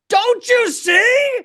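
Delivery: high-pitched, drawn-out cry of disbelief; exasperated plea. Acted. Comic summing up every livestream in one sentence, exasperated plea. Sums up own message as outcry.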